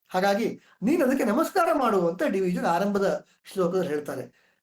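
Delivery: ordinary talk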